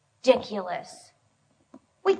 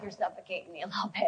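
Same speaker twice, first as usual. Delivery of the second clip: quick, softer, lightly voiced husband; husband teasing; 5 semitones lower, 8 dB quieter, faster than usual. Dan teasing wife about clinginess, quick and soft. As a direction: toss it off as the teased husband. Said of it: "now Dan's like".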